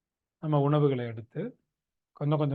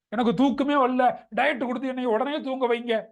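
louder, higher mock complaint; raised-pitch patient whine, mimicked; imitating demanding patient; 6 semitones higher, 4 dB louder than usual. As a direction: demand an instant cure like a fed-up patient. Acts out sleepless patient's demand.

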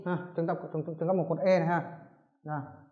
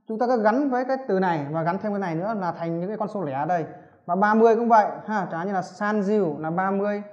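usual speaking voice

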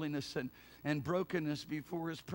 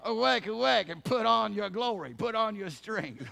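raised, ringing command to awake; God's answering summons; 4 semitones higher, 5 dB louder than usual. Acted. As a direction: declaim it as a divine rallying call. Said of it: "he turns around and he says".

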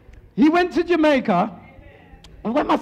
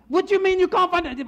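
higher, fast, indignant customer; indignant protest; 6 semitones higher, faster than usual. Acted. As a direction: fire it off in disbelief. Acted lady protests lost dog.

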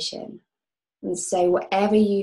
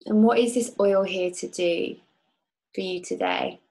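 calm guide leading a visualization; guided meditation. Acted. Leads a guided oil meditation.

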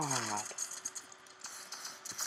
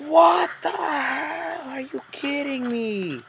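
high, loud, drawn-out disbelief; real rage; 7 dB louder, slower than usual. Real, not acted. Gamer raging at his match, high loud disbelieving outburst. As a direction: let it burst out, stretched and high. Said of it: "So much goddamn rage".

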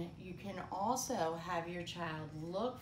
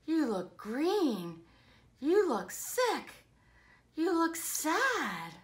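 concerned; 4 semitones higher, 9 dB louder than usual. Acted. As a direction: gently build worry, one short phrase at a time. Concern for sick Minnie.

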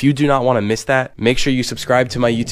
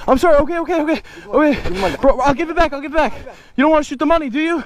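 panicked pleading; 14 semitones higher, faster than usual. Acted. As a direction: plead for your life, hammed-up. Begging gunmen not to shoot.

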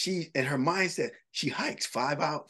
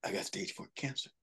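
retold in a near whisper, softer; hushed phone news; 3 semitones lower, 9 dB quieter than usual. Friend calling with bad news, hushed. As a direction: let it drop to a breathy hush.

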